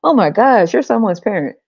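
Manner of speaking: normal talking voice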